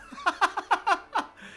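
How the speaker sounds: high, airy laugh shown as an example; demo laugh. Shown as a demonstration. Preacher demonstrating a recognizable laugh to the congregation, high laugh. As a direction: laugh out to show a voice's signature. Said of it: "lo que es característico es la risa".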